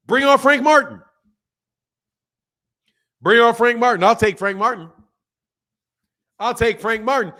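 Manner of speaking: punchy, loud dare; calling out a challenge. Acted. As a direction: call out the next opponent, loud and bold.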